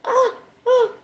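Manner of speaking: mock frightened. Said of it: "I be like".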